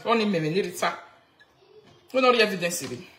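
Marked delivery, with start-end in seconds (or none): none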